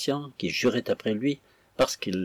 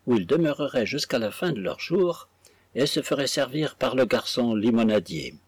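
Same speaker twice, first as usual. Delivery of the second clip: ordinary delivery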